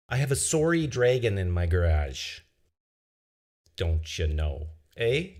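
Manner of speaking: light Canadian accent, hammed up; lightly put-on Canadian accent leaning on the eh. Shown as a demonstration. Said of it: "Please say this in your most con-, Canadian accent".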